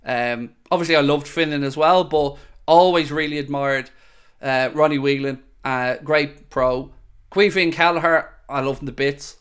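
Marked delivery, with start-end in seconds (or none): none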